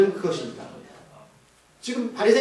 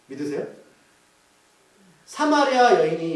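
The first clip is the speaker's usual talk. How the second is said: ordinary delivery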